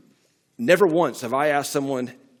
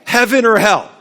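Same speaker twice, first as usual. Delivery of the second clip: loud, high, confrontational fire-and-brimstone question with a laugh; hammed-up scare-tactic evangelist; pushy old-style evangelist; 8 semitones higher, 11 dB louder than usual. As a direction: corner them with hell like a street preacher. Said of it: "This is what we did, and we called that evangelism".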